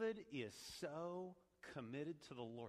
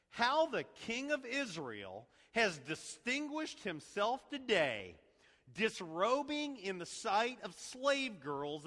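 slow, higher, biting mock praise; sarcastic; 7 semitones higher, 8 dB louder, slower than usual. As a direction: cut at him with sarcasm. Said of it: "Do you hear the sarcasm?".

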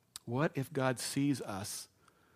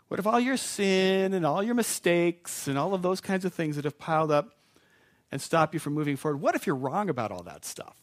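louder, rising preacher's push; impassioned sermon build; building preaching; 4 semitones higher, 5 dB louder than usual. Acted. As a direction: drive the question up to the crowd.

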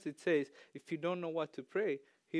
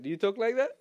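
ordinary talk

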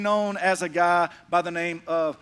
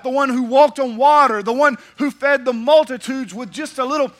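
passionate; 5 semitones higher, 8 dB louder than usual. Retold or imitated. Believers explain Jesus to Saul.

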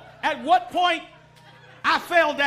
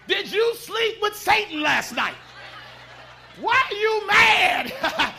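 mock question; 4 semitones higher, 4 dB louder, slower than usual. Mocking mean people waking up.

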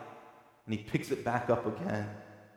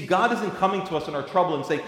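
usual speaking voice

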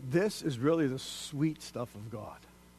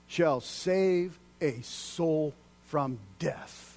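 slow emphatic close; much slower than usual. Acted. Slowed climax of verse.

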